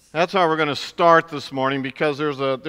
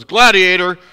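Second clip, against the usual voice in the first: loud angry demand, higher and louder; upset emperor; 5 semitones higher, 12 dB louder than usual. Retold or imitated. Emperor shouting at the gladiator who turned his back. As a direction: bark it like an outraged emperor. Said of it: "Commodus gets very upset and says".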